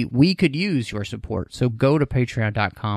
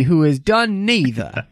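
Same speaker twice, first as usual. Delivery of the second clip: ordinary talk, nothing marked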